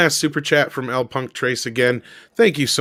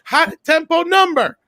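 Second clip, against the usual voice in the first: very high, exasperated mock tirade; Casey Kasem going off; 13 semitones higher than usual. Radio host fuming over a song cue, mock tirade. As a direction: imitate a host ranting at his producers. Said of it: "just going the fuck off".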